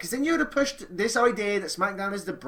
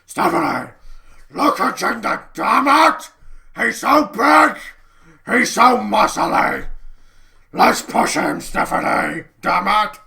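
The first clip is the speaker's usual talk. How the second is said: loud, higher, slow ranting bellow; Vince ranting; 3 semitones higher, 11 dB louder, slower than usual. Pretend. Vince to Stephanie, loud, higher excited ranting. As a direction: rave about the big muscles.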